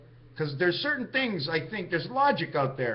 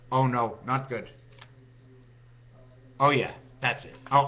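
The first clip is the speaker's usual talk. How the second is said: muffled and lower, a thumb in the mouth; talking with thumb in mouth; 6 semitones lower than usual. Shown as a demonstration. Testing how he sounds to himself, talking round his thumb. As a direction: speak around a thumb pushed into the mouth. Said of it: "and I talk like this".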